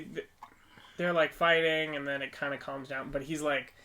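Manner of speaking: ordinary talk, nothing marked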